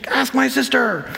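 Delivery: put-on high, slow sulky kid; whiny kid. Child dodging the kitchen chore, sulky whine. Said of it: "have you ever asked your kid".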